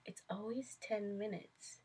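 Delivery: quiet, matter-of-fact aside; understated self-quote. Retold or imitated. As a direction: say it softly, as if to yourself. Quotes own routine remark, then repeats laughing.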